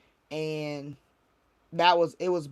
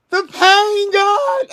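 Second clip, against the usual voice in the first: anguished high wail, much louder and higher; crying out in pain; 13 dB louder, much slower than usual. Retold or imitated. To God in the mirror, a loud anguished wail. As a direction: cry it out, breaking and loud. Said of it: "I was crying out".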